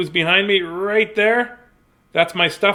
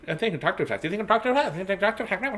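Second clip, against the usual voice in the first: silly speeded-up babble, softer; pretending to talk fast; 5 semitones higher, 3 dB quieter, much faster than usual. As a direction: race the words into playful gibberish. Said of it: "pretending to be fast".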